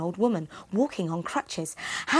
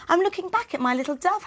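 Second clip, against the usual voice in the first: put-on higher, quick little boy voice; a child explaining eagerly, higher and fast; boy Hansel; 10 semitones higher, faster than usual. Hansel tells his father, high quick boy.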